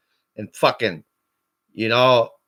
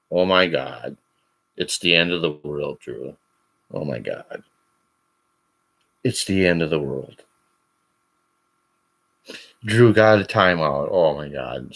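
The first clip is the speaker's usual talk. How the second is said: low, soft sarcastic wailing; mock him with fake drama; mock despair; 11 semitones lower, 3 dB quieter than usual. Mocking Drew for sulking over a timeout, sarcastic mock despair.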